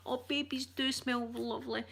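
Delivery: usual speaking voice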